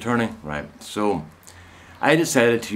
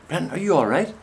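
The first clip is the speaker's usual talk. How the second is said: higher mock woman's voice, lightly concerned; worried chemist; 9 semitones higher than usual. Chemist on the phone asking Brenton if he's okay, higher mock worry. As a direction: play her worried but only lightly. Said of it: "She said".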